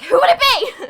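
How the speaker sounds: very high, loud shout; imitated crowd cry. Other cats echo the question, shouting high. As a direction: squeal the question like a whole excited chorus. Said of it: "all the other cats are like".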